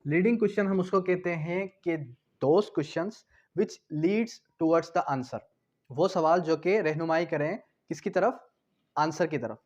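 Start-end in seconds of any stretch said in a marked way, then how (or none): 2.4-5.4 very slow, each word laid out for note-taking; spelling out a definition word by word; slow dictation. Shown as a demonstration. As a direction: dictate it so the class can write it down. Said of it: "teacher dictates the English definition".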